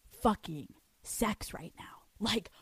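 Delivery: breathy stage whisper; hushed conspiratorial aside. Acted. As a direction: lean in and whisper it like a secret. Confiding the juicy part.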